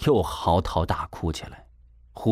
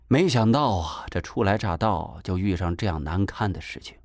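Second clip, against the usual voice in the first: ordinary delivery, nothing marked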